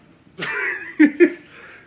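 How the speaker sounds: bursting into a high, loud laugh. Real, not acted. Laughing after warning the family off the hot grease. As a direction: let out a big high cackle.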